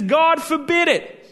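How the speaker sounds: high, loud horrified "God forbid it"; Peter's protest. Acted. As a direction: blurt it out like an appalled disciple. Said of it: "In fact, he says".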